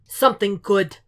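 loud, booming menace; thundering Fisk. Acted. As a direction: thunder it from behind him. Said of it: "Fisk, loud and thundering".

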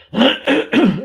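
loud, high throat clearing. Real, not acted. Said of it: "alleine durch diesen Räusperer".

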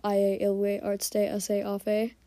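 "Aye, Ilwe, Artste, Ase, Afe" in drawn-out Pig Latin syllables. Shown as a demonstration. Podcaster imagines a cat opening a meeting in Pig Latin. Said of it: "then I will start us off".

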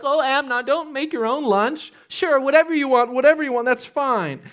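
high, loud pampering voice; doting father. Retold or imitated. Father indulging his lazy son's every wish. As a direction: gush like a father who gives in. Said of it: "David's like".

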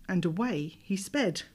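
ordinary delivery

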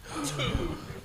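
sharp breathy gasp; hammed-up bedroom gasp; mock gasp. Acting out bedroom moan.

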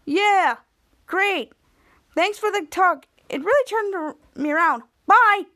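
high-pitched mock gratitude, ending on a loud bye; sarcastic. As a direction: thank them sarcastically, then punch the goodbye. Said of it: "Henry said sarcastically".

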